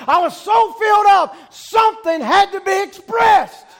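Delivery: high, loud pulpit shout; full-force preaching. Real, not acted. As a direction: holler it like you're overflowing.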